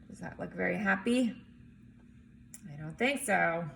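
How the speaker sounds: ordinary delivery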